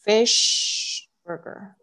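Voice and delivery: slow fish burger, sh held; long SH in fish. Shown as a demonstration. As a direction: stretch the sh at the end of fish. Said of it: "你要注意一下你的S H的发音".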